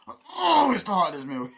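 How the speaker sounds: hammed-up constipated bellow; exaggerated aggressive straining. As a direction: force it out as if straining on the toilet. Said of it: "aggressively, like I was taking a shit".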